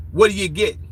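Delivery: usual speaking voice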